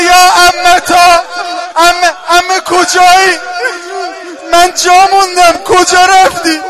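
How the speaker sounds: slow loud wailing for mother; drawn-out cry for a mother who left; loud slow lament of an abandoned child. Acted. Lost child crying for mother.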